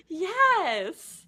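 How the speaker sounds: high, playful Southern exclamation; fake Southern accent. Friend joining in the character's reply, high fake Southern accent. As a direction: echo it in a mock Southern twang. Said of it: "a fake Southern accent for fun".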